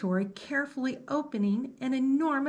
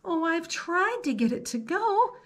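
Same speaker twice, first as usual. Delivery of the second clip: put-on higher, whiny kid voice; 8 semitones higher than usual.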